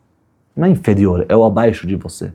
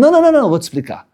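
acted protest; much faster than usual. Acted father hastily correcting himself.

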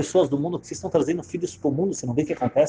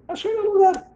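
high-pitched exclamation. Retold or imitated. Wedding guest discovering the phone booth, high-pitched exclamation. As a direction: squeak it far higher. Excited repeat of finding refuge.

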